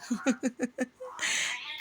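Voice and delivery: high, soft giggle; soft, high giggle while feeding the pet myna; soft laugh. Real, not acted. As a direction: laugh quietly at the bird.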